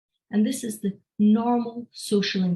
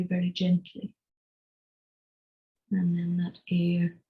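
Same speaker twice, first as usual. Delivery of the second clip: guided breathing. Acted. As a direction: ease them gently into each breath. Leads a breathing exercise.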